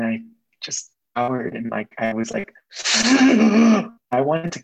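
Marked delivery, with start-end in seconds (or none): 2.7-3.9 high loud gasp from cold water; loud sharp gasp. Real, not acted. Stepping into an ice cold shower, sharp gasp.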